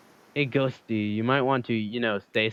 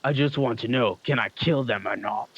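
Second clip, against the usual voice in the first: killer voice. Acted. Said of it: "that actually works, his voice".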